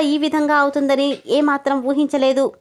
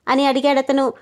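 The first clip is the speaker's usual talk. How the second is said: ordinary delivery, nothing marked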